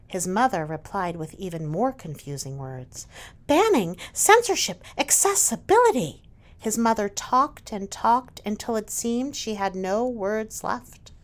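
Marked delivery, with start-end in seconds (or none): 3.5-6.2 indignant retort. Acted. Mother retorts with charged words.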